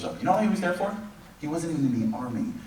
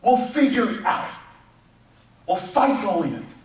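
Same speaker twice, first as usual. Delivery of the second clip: rousing preaching; 12 semitones higher, 4 dB louder than usual. Acted. Rousing sermon climax.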